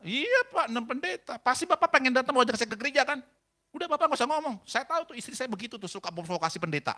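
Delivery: high, loud acted tirade; snapping at him. Husband rebuffing the pastor his wife sent, barking. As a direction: cut the visitor off in mock anger. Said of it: "Karena dibentak saya".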